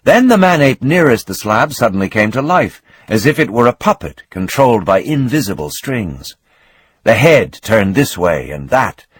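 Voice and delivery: usual speaking voice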